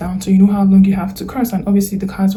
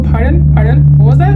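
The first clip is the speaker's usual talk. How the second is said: higher, louder mock query with rising ends; puzzled listener; 5 semitones higher, 6 dB louder than usual. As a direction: imitate someone who could not catch a word. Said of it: "they're gonna go".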